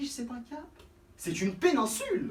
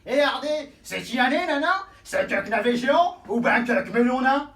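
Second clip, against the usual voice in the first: put-on louder, higher rustic yokel accent; broad peasant accent; country bumpkin; 4 semitones higher, 7 dB louder than usual.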